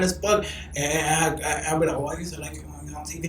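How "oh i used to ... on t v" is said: calm, discreet. Acted. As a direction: say it quietly, as if not to draw attention. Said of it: "calm, discreet".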